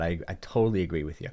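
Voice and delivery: normal talking voice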